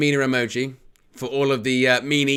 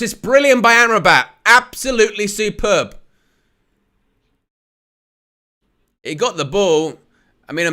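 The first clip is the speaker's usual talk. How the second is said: excited play-by-play, louder on the big moments; live match commentary; 6 semitones higher, 6 dB louder than usual. Acted.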